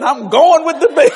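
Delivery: usual speaking voice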